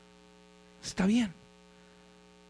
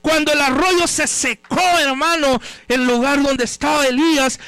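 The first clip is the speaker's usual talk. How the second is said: forceful, driving sermon delivery; fervent preaching; 4 semitones higher, 6 dB louder than usual. Real, not acted. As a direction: proclaim it to the congregation with long-stretched key words. (predicación ferviente y enérgica)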